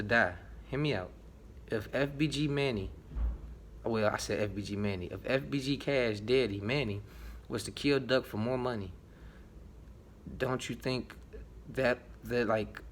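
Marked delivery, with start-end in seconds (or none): none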